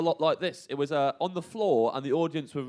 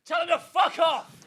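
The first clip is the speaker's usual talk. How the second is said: higher, louder angry yell; crowd shout; 5 dB louder than usual. Retold or imitated. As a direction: shout it at the stage. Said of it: "really loud".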